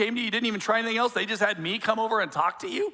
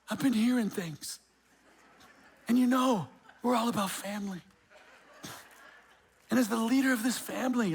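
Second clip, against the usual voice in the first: lightly voiced hammed-up pastor scolding the spouse; mock spiritual godfather; 3 semitones higher than usual. Pastor mocking himself as godfather scolding a spouse, hammed-up. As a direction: play a pompous godfather pastor, half joking. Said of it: "The, the spiritual godfather?".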